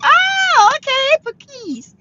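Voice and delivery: a sudden high bright catch of understanding; surprised realisation. Real, not acted. Host realising she misread a name, high surprised squeal.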